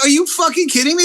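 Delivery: high, fast indignant retort; retold incredulous outrage. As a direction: throw it back in disbelief. Retelling his outraged reply.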